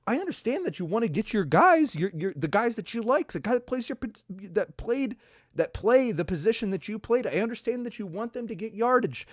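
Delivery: high, frustrated ranting at coaches; pleading, fed-up complaint; exasperated rant. Real, not acted. Fan ranting at the coaches about play calls, exasperated.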